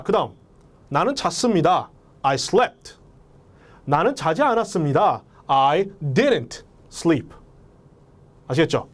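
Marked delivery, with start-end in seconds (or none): none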